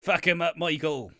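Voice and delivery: hammed-up Irish farmer ringside. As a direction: cheer him on like a rural fan. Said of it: "in the farmer voice".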